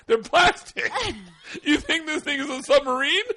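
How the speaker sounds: high, loud disbelieving comeback; incredulous retort. Retold or imitated. Man answers her about the rain flaps, loudly incredulous.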